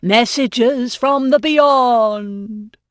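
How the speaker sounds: hammed-up séance cry; slow theatrical proclamation. A narrator's reading. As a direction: stretch it out like a showman medium. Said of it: "he cried".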